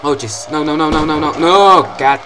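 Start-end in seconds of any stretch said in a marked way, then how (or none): 1.4-2.2 loud drawn-out cry of dismay; dismayed shout. Real, not acted. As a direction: shout it as the move goes wrong. Move goes wrong in game.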